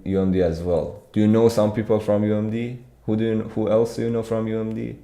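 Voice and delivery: usual speaking voice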